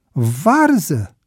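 stunned question, voice swooping up; astonished. A narrator's reading. Bebe hears the plan is to fetch cabbage, astonished. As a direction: echo it in utter disbelief. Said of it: "întrebă Bebe uluit".

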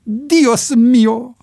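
loud, high cry of despair; desperate cry. A narrator's reading. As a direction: cry out as the crash comes. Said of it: "strigătul disperat". (strigăt disperat, înalt și tare)

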